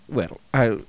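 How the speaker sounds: ordinary delivery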